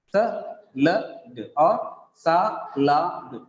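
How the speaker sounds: Salad or salad with equal weight on each syllable; flat even stress. Shown as a demonstration. Teacher showing the wrong flat stress, slowly. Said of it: "it would be salad or salad".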